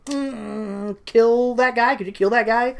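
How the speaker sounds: high-pitched sweet coy request. Acted. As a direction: ask it sweetly and coyly. Wife voiced again.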